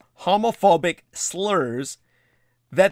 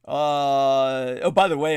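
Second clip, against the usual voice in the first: usual speaking voice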